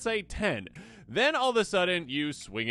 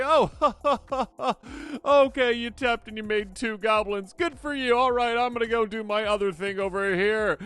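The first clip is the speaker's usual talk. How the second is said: higher, louder, dismissive mock opponent voice with a laugh; smug opponent; 8 semitones higher, 4 dB louder than usual. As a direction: brush off the goblins like a cocky rival. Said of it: "you're like".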